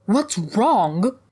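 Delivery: loud, high, incredulous repeat; outraged echo. Acted. Hag echoes the hunter's question, outraged. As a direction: throw the question back in outrage.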